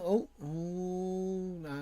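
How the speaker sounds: ordinary talk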